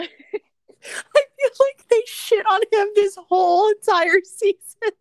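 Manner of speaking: high, loud, slow mock crying; hammed-up crying complaint about Steve's treatment; mock wail. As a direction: wail it like fake sobbing through laughs. Mock-cries over Steve.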